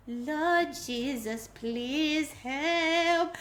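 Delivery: slow, drawn-out plea to heaven; mock prayer. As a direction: act out a helpless little prayer. Acts praying in front seat.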